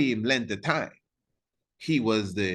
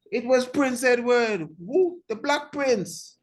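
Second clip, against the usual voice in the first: much higher, drawn-out call and answer; quiz-show answer; 13 semitones higher than usual. Acted. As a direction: sing out the answer like a game host. Quizzing the audience, answering himself.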